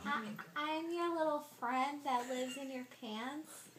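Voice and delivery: high little character voice; lightly voiced tiny creature; squeaky character voice. Voicing a body part prank.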